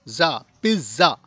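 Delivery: "ज़ा" alone, then "पिज़्ज़ा" in two separated parts; low, split "पिज़्ज़ा" after "पिज़"; syllable breakdown. Shown as a demonstration. Teacher showing pizza's two syllables, syllable by syllable. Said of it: "दो syllables कैसे हुआ".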